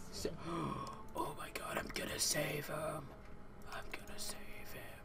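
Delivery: hushed whisper. Pretend. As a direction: whisper it like a secret. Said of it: "Just kidding".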